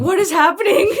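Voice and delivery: high, loud retold astonishment; starstruck disbelief. Re-living the brain freeze at the screen, starstruck. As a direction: act out being completely starstruck. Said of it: "I am completely starstruck".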